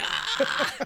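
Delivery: high-pitched imitated scream; drawn-out mock shriek of terror. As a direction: imitate a terrified kid's scream, half laughing. Kid screams before the oven.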